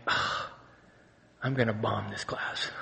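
low, soft defeated groan; mock despair. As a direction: sigh it out like a struggling student giving up. Retells despair over hard assignment.

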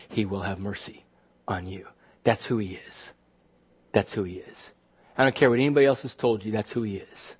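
soft, low, earnest assurance; sincere reassurance. Real, not acted. Preacher assuring friends that Jesus will have mercy, sincere reassurance. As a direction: drop low and quiet to comfort. Personal assurance to congregation after aside.

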